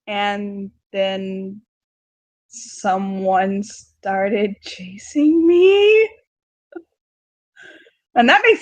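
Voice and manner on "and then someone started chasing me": very slow, spinning the story out; drawn-out. Acted. Daughter telling dad her wild night, slowly. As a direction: stretch every word to build suspense.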